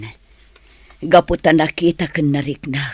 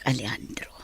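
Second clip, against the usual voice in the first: whisper; 3 semitones lower, 11 dB quieter than usual. Acted. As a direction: breathe his name out softly. Ailing woman whispers his name.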